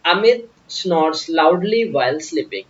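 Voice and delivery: very slow, word by word; slow example read. Shown as a demonstration. Teacher dictates example sentence slowly to class. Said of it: "reading example sentence slowly".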